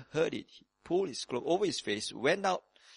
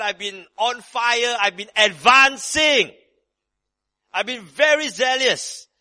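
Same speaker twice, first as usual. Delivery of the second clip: higher, louder, zealous preacher's retelling; fired-up Elijah; 7 semitones higher, 7 dB louder than usual. Retold or imitated. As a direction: voice Elijah all fired up and proud. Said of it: "Now this is rah-rah Elijah speaking".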